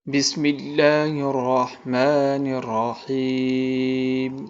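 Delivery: slow, melodic tajweed recitation; Quran recitation. Shown as a demonstration. Teacher reciting the opening for students, slow and melodic. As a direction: recite slowly, drawing out each vowel.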